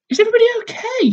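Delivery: put-on a child rattling out a worried question; Jill. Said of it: "says Jill".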